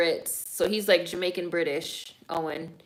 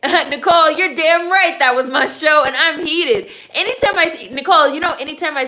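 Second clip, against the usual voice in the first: loud, high, fired-up reply; a fan worked up and loud over her cancelled show; excited; 8 semitones higher, 9 dB louder than usual. Real, not acted. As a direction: get heated and loud about your show. Excitedly answering a viewer's comment.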